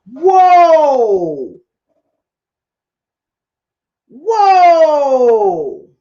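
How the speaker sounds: loud, long falling whoa; shocked whoa. Real, not acted. Storyteller shocked by the cruelty, loud whoa. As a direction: hold the whoa out, stunned.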